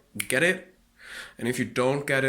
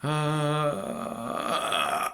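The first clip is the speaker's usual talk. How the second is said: false fold fry; 5 semitones higher than usual. Shown as a demonstration. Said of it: "Listen carefully".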